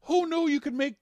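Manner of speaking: high, loud exclamation of wonder; amazed. Real, not acted. Marveling at a first vegetarian meal, loudly amazed. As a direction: belt it out in happy disbelief. Amazed at good meatless food.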